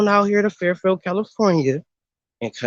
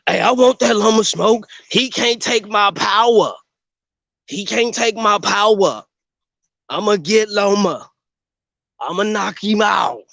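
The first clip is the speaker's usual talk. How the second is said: higher cocky callout, lightly voiced; cocky breathless boxer boasts; 5 semitones higher than usual. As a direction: boast like a hyped-up fighter. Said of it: "Tank finna call the shit out of Llama".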